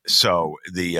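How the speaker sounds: ordinary talk, nothing marked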